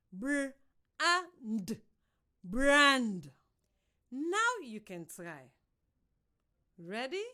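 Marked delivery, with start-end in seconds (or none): 2.4-3.3 Brand said loud and firm as one blended word; emphatic blend. Shown as a demonstration. Teacher modelling a blend: loud, firm Brand. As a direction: punch out Brand after the sounds. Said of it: "first you listen".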